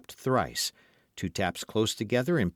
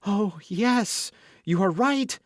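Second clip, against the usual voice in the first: high, loud, alarmed lady; frightened cry; 11 semitones higher, 4 dB louder, slower than usual. A narrator's reading. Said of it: "cried the affrighted Madame Bonacieux".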